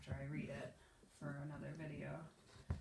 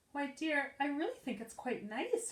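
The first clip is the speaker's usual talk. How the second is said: kindly mother; 7 semitones higher, 4 dB louder than usual. Acted. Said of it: "her mother said kindly".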